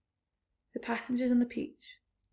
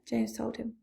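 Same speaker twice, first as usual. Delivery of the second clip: ordinary delivery, nothing marked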